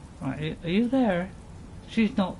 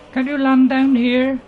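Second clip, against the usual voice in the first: high, loud hail; calling out; 5 semitones higher, 6 dB louder than usual. Pretend. Player asking the dragon to land, loud and high. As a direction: call up to someone far above.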